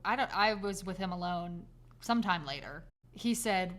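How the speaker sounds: ordinary talk, nothing marked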